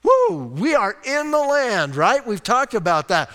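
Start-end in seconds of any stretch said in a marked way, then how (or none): none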